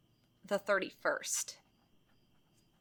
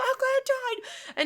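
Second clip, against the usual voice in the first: much higher, slow, drawn-out wail; horrified patient; slower than usual. Retold or imitated. Retelling the movie patient realising he died, high slow wail. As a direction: wail it in mock horror. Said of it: "And he's like".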